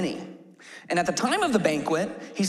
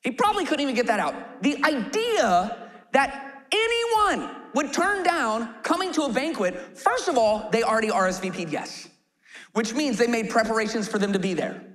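usual speaking voice

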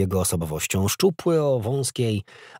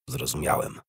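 lower, softer murmured reply; low mutter; 5 semitones lower, 6 dB quieter than usual. A narrator's reading. Soldier acknowledging his superior's correction, muttered low. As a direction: mutter it under your breath. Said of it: "mruknął Marin".

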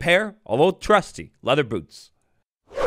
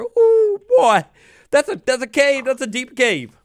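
excited; 8 semitones higher than usual. Real, not acted. Sudden excitement at a cave.

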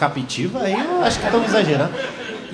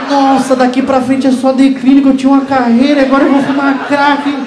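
mock panic; 2 semitones higher, 4 dB louder than usual. Panicked inner monologue about drugs.

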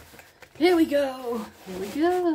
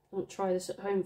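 usual speaking voice

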